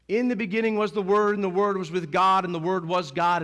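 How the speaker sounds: loud, high proclamation of the verse; preaching scripture. Acted. Preacher proclaiming John's opening verse, loud and high. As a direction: declaim it like a pulpit crescendo.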